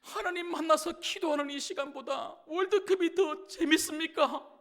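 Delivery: loud, high-pitched pleading, as if crying; imitated tearful prayer. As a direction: act out a pastor complaining to God in tears. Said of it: "막 울면서". (울면서 하소연하듯 기도하는 흉내)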